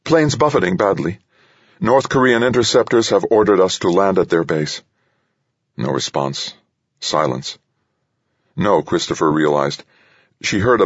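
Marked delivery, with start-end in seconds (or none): 0.0-4.8 higher, urgent cockpit report; shaky report. A narrator's reading. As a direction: report trouble, slightly shaken. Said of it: "surprised at how shaky her voice sounded".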